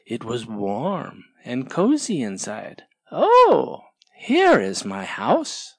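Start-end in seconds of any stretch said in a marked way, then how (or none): 3.1-5.7 slow, higher oh of wonder; happy discovery. Acted. Tortoise finds his home.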